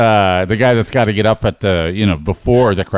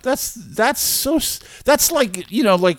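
much higher, softer mock scolding voice; a teasing prude, much higher and softer; mock disapproval; 12 semitones higher, 3 dB quieter than usual. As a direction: play the prim disapprover, high and soft.

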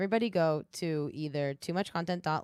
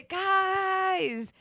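excited; 13 semitones higher, much slower than usual. Real, not acted. Triumphant announcement.

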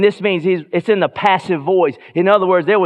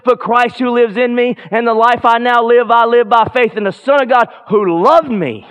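higher and louder, building to a shouted Yes; full-force preaching; 8 semitones higher, 5 dB louder than usual. Acted. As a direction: preach it out with fire to the congregation.

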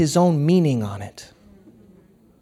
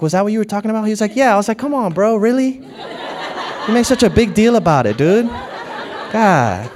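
louder, higher, laughing protest; laughing disbelief; 5 semitones higher, 4 dB louder, faster than usual. Retold or imitated. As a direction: tease a friend through laughter. Retold laughing protest to friend.

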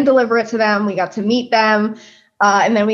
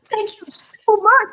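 much higher, slower, gushing thanks; imitating delighted thanks; 11 semitones higher, slower than usual. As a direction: gush like a thrilled fan of the cake. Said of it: "He's like".

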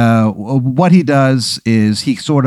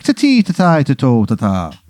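lightly put-on Irish lilt, nonsense syllables; a sing-song leprechaun babble; bad Irish accent. Leprechaun ghost babbling nonsense, mild Irish accent. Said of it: "the worst Irish accent you've ever heard".

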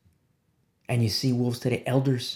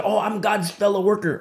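sarcastic, higher imitation of a self-righteous claim; mocking quote; 6 semitones higher than usual. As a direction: mimic the smug boast. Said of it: "Okay, okay".

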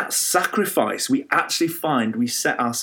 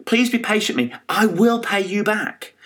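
mildly pleading, pitched higher at no extra volume; retold begging; 7 semitones higher than usual. Servant begs his creditor for patience, mild retold pleading. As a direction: plead a little, as in retelling a parable. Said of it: "he begs him and says".

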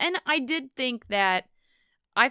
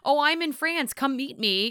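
mock invitation; 7 semitones higher than usual. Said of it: "it was nothing".

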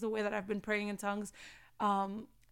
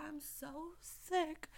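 slow, soft, pitiful mock-sick moan; lightly hammed-up sick whine; 6 semitones higher, 10 dB quieter, much slower than usual. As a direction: play the poor sick victim, slow and soft. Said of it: "would love for me just to be like".

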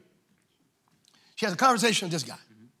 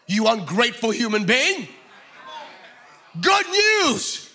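angry angel; 7 semitones higher, slower than usual. Acted. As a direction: thunder it down at an ungrateful man. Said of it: "very accelerated yelling moments".

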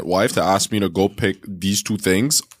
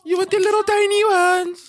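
squeaky tiny voice. Pretend. Mocking tiny pickles.

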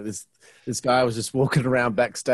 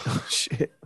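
laughing; 4 semitones higher, 7 dB quieter than usual. Real, not acted. Reacting to punch-out plan.

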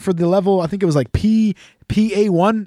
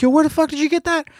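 high, startled demand; pitched-up shock at a friend's hoodie; surprised; 9 semitones higher than usual. Retold or imitated. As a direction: blurt it out in disbelief. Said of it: "And I'm like".

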